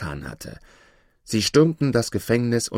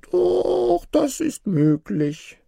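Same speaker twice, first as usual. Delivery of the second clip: very high, slow little creature; obliging dwarf; 4 dB louder, much slower than usual. A narrator's reading. Dwarf granting Aladin's request, high and obliging. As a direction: squeal up high, then draw it out.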